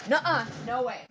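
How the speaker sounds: loud, high, quick refusal; sharp scolding. Real, not acted. Owner stopping the dog mid-video, loud high scolding.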